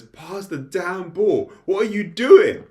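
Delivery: slow then exploding into a loud high yell, laughing; retold angry shout. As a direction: bark it at a useless teammate, cracking up. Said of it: "Shido just shouts at him".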